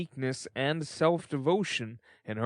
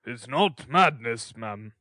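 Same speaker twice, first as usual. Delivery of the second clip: a hint of pompous beadle, louder; 5 dB louder, slower than usual. A narrator's reading. Beadle to undertaker's wife, lightly voiced pompous verdict. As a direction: pronounce it gravely. Bumble pronouncing judgment.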